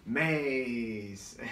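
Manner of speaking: drawn-out cartoon voice; Grum, animated character. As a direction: stretch out MAYS as an animated character. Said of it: "and Grum goes".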